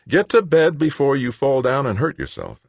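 no-nonsense order to rest; firm. A narrator's reading. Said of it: "Anson said firmly".

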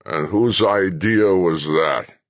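put-on slow, menacing old general; suspicious glare. A narrator's reading. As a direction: drag it out, glaring. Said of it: "The wizened old man glared at him".

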